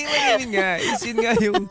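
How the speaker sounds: ordinary talk, nothing marked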